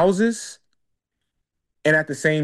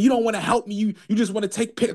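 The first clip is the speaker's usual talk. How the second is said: mimicked cursing; 6 semitones higher, faster than usual. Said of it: "She's cursing 'cause I'm taking".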